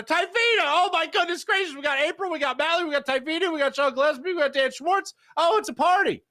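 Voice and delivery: high, rising excited list; gleeful party-host roll call. Real, not acted.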